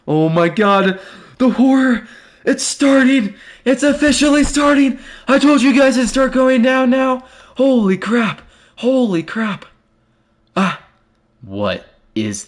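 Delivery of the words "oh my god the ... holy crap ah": high panicked yelling at the screen; terrified streamer screaming at a jump scare; real frightened shriek. Real, not acted. Horror game scare, streamer.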